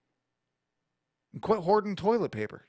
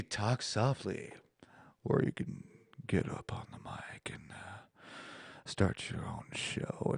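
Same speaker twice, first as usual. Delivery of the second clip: mock sultry whisper; 8 semitones lower, 11 dB quieter than usual. Suggests seductive soft talk.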